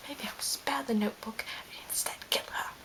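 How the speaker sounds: sinister whispered aside. Acted. Character plotting to kill her, sinister whisper. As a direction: whisper the threat conspiratorially.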